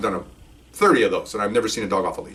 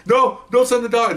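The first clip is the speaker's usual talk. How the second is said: high, slower, raised cry not to send the dog; louder pleading; 11 semitones higher than usual. Retold or imitated. Burglars begging police not to send the dog, loud and high. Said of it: "their voices are getting louder".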